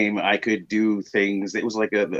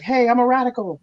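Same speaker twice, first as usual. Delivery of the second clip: high bright voice of his wife; wife announcing she's a Radical; wife's proud claim; 14 semitones higher, 4 dB louder than usual. Retold or imitated. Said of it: "she's like".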